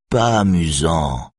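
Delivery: slow, lightly sulking pout; boudeur, lentement; sulky. Acted. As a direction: drag it out like a pouting child. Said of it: "répond Ossian d'un air boudeur".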